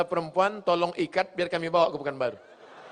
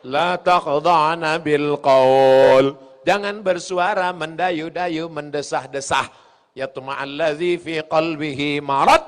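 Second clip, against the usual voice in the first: usual speaking voice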